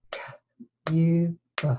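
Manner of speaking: K-U-B, each sound split apart in a plain voice; sounding out words. Shown as a demonstration. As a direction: segment cube into K, U, B aloud. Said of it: "what were the sounds in cube".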